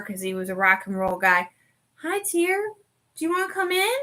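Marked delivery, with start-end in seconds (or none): 2.0-4.0 much higher, sing-song voice for the dog; pet talk. Real, not acted. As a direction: coo at the puppy to coax her in. Talking sweetly to her dog.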